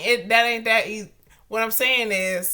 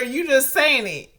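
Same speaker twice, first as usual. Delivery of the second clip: usual speaking voice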